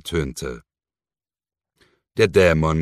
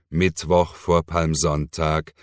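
ordinary delivery

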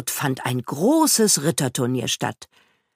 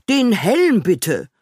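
a slow, drawn-out request, slightly higher; lightly voiced knight; 5 semitones higher, 4 dB louder, slower than usual. A narrator's reading. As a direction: give a measured knightly order, voice lifted a little. (leicht gespielter Ritter, gedehnt)

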